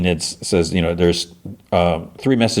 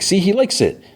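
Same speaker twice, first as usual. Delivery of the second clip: put-on squeaky, much higher gleeful kid voice; delighted kid; 13 semitones higher than usual. Pugsley showing Eugene the zombie likes honey, gleeful and high. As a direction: chirp it like a proud little brother.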